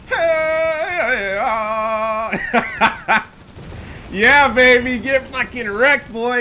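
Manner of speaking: high, loud triumphant hollering; gloating whoop. Real, not acted. Streamer crowing at his wiped opponent, loud and high. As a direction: crow over the kill like a hyped fan.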